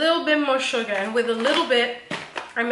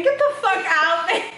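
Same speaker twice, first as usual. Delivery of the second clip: laughing disbelief; 5 semitones higher than usual. Real, not acted.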